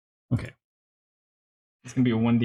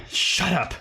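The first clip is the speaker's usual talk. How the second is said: curt abbot; 6 semitones higher than usual. Acted. Abbot snaps at his daughter.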